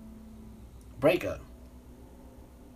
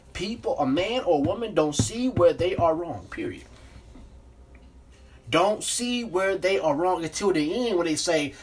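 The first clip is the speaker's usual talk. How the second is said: ordinary talk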